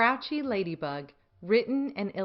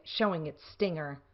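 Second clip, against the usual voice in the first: ordinary delivery, nothing marked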